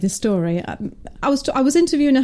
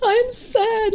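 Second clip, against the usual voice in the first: put-on very high, sad small-child voice; little girl; 13 semitones higher than usual. Little girl telling her mum after school, sad child voice. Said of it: "And the little girl goes".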